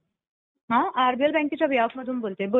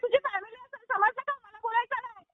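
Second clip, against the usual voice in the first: high, heated and a bit softer; angry retort; 12 semitones higher, 10 dB quieter than usual. Real, not acted. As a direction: snap back at the customer, indignant. Phone quarrel with angry customer. (उंच आवाजात चिडून फटकारणे)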